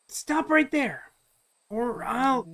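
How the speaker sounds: put-on high-pitched young hero challenging a stranger; Luca. Luca confronting the mysterious large figure, high-pitched challenge. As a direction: call a halt in a high character voice. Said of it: "Luca's voice for some reason by pitching high".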